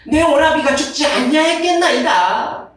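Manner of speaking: a touch of aggrieved Martha; lightly voiced complaining woman; Martha's complaint. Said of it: "하면서 불평을 늘어놓습니다".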